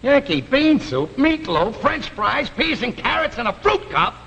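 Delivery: scornful, high-pitched recital of the menu; mocking echo. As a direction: throw each dish back with mounting disbelief. Husband mockingly repeats the menu.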